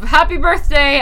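high, loud birthday cheer; hammed-up party greeting; mock birthday greeting. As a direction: announce it like a gift-giver. Acting out giving a birthday gift.

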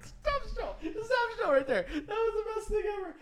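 high, laughing through the words. Real, not acted. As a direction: crack up while talking. Host cracks up at kid.

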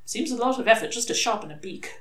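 ordinary delivery, nothing marked

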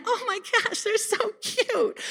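God delighted like a parent taking pictures; doting delight. Retold or imitated. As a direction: coo like a proud parent. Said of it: "He's like".

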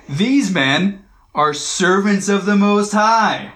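high, drawn-out mock proclamation; sarcastic mocking. Preacher imagines a possessed girl taunting Paul, sarcastic. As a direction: proclaim it with exaggerated, slow reverence to mock. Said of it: "saying it in a sarcastic way".

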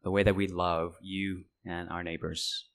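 low, soft praying voice; hushed, measured prayer to God; closing prayer. Real, not acted. Closes a prayer.

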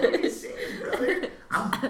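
loud words broken by laughter; laughing. Real, not acted. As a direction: talk through the giggles. Cracking up after his impression.

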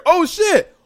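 acted dread, building to a loud exclamation; mock alarm. Acting a villain's alarm at All Might.

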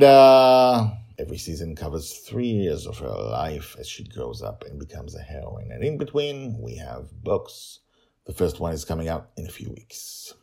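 plain reading voice

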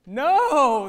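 loud, sharp shout of no; driver shouting. A narrator's reading. Truck driver refusing the kids, loud dismayed shout. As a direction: bark it out in dismay. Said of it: "the ice cream truck driver shouted".

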